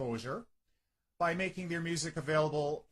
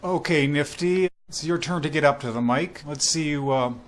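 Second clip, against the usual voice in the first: booming louder radio-announcer voice introducing the show; radio host intro; 3 semitones lower, 10 dB louder than usual. Acted. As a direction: welcome listeners like a big-time radio host. Radio host opens comedy show.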